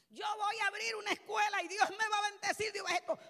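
loud pulpit proclamation; impassioned preaching. Acted. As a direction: proclaim it boldly, loud and high. Preaches at full force.

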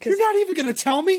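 protesting to the co-host that she won't tell the answers; indignant surprise. Real, not acted. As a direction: squeal it in mock outrage. Host refuses to reveal answers.

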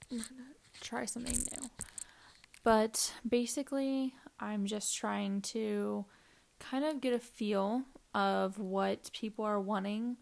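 ordinary delivery

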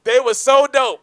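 loud, high, thrilled exclamation; excited praise. Real, not acted. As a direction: burst out with real admiration. Excited right after the big reveal.

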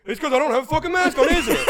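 loud, high-pitched heckler imitation; mock yelling. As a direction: blast it out as the outraged stranger, hammed-up. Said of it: "He's yelling".

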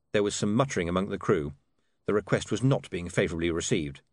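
plain reading voice

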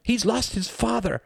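very high, drawn-out yell; outraged cry. Acted. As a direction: cry out in reproach. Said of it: "cried Reginald".

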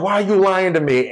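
high, fast mock whine of accusation; drunk woman accusing. Drunk woman challenging her man, high indignant demand. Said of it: "The girl was sauce".

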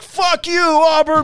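very high, loud mock rage; drunk furious outburst. Drunk fan cursing the rival team, high loud mock rage. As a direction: screech it like an offended drunk fan. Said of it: "He's like".

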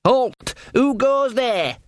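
high, drawn-out sentry call; guard's challenge. A narrator's reading. Sean on guard challenges arrivals in a high sentry call.

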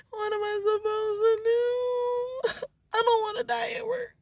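overly dramatic sobbing panic; hammed-up crying wail; high wailing cry.

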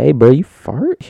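loud, high, drawn-out question; acted classmate callout. Classmate asking who farted, loud mock accusation. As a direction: call out an accusing question, hammed up.

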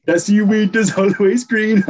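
lilting cartoon-crab take on a Jamaican accent; Sebastian. Egging on the co-host with a song line, mock Jamaican accent. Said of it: "Sebastian from the Little Mermaid accent".

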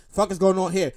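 low, quiet nervous mutter under the breath. Retold or imitated. Spooked at the security office, muttering low and quiet. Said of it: "I, I'm nervous".

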